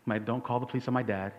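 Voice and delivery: ordinary talk, nothing marked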